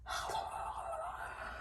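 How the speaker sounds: long unvoiced sigh; exasperated sigh. Real, not acted. Printer still not working, weary sigh.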